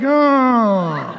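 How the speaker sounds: low, drawn-out "Oh" sinking down; deflated "Oh". Shown as a demonstration. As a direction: let the exclamation droop, unimpressed. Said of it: "Can't do it like that".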